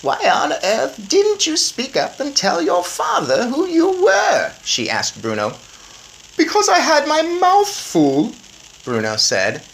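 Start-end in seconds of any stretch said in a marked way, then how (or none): 0.0-4.5 high, slow grandmother voice. A narrator's reading. Grandmother scolding the grandson, high and slow. 6.4-8.3 put-on high, slow cheeky boy; greedy boy Bruno. A narrator's reading. Bruno answering grandmother, high cheeky boy voice.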